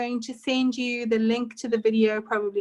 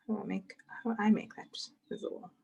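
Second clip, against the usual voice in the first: quick whisper under her breath; whisper to self; 2 semitones lower, 9 dB quieter, faster than usual. Real, not acted. Teacher sorting out her camera view, quick whisper to herself. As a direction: murmur it privately while fiddling, slightly hurried. Fiddling with the screen settings.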